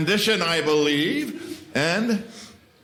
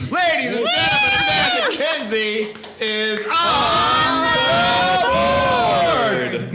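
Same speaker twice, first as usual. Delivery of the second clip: much higher, louder game-show announcement, stretched out; booming announcer call; 10 semitones higher, 4 dB louder, slower than usual. Acted. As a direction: belt it like a boxing ring announcer. Host announces next contestant.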